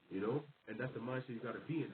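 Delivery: ordinary talk, nothing marked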